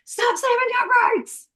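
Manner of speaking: high-pitched, drawn-out acted outburst; the harsh way of nagging, hammed up; mock angry yell. Shown as a demonstration. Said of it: "It's not me going".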